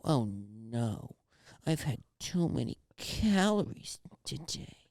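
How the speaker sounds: soft, slow, creepy moaning over too much sugar; drawn-out, uncomfortable guilty moan; moaning softly, like a sugar-guilty creep. Shown as a demonstration. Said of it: "this is how this voice goes".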